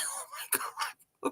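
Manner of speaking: breathless whispered disbelief; stunned hushed whisper; awed whisper. Retold or imitated. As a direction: gasp it under your breath. Stunned by the yellow sign.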